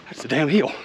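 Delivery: ordinary talk, nothing marked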